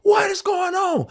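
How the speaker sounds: high, slow shouted disbelief; amazed shout. Retold or imitated. Winner calling out to fellow winner Ty, high amazed shout. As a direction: call out to Ty in joyful shock. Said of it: "I'm like".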